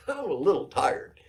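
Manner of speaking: mock plea broken by real laughter; laughing repeat. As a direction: crack up at the silly excuse.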